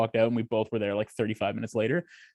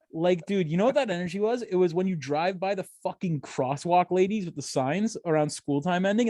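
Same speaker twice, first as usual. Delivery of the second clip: normal talking voice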